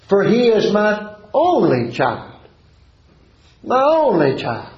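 high, drawn-out stress on "only"; father's plea, stretched and lifted in pitch; pleading cry. Acted. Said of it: "And he cried out".

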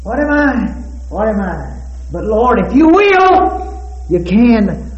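preaching climax. Real, not acted. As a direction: ring it out to the rafters.

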